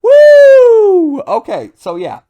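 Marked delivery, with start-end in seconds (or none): 0.0-1.2 very high, very loud whoop of joy; hyped whoop. Real, not acted. A fan thrilled at the big fight moment, whooping very high and loud. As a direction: let out a huge whoop. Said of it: "I got super hyped about it".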